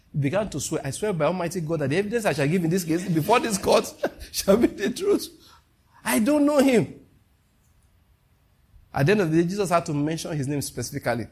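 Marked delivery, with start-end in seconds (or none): 0.8-7.0 higher and rising to the top, hammed-up solemn swearing with a laugh; hammed-up witness taking the oath; mock courtroom oath. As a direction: play Peter swearing in court, then blurting the denial high.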